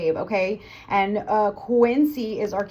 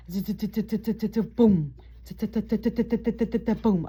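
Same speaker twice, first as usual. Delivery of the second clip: long buzzing zzz then a boom; buzzing sawtooth; 3 dB quieter, much slower than usual. Shown as a demonstration. Teacher buzzing the flutter sawtooth for students. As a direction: buzz out the flutter waves. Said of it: "it'll look like sawtooth".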